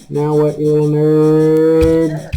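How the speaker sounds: slow, sing-song jeer at a little enemy; mock-taunting a monster, dragged out slowly; drawn-out mocking taunt. Real, not acted. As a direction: stretch "nerd" out slowly, teasing.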